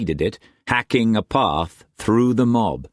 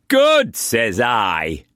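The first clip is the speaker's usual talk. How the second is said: slow, high-pitched gloat; mocking; 4 semitones higher, much slower than usual. A narrator's reading. Brock glancing at Struan, mocking him. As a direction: draw it out to taunt. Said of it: "mocking him".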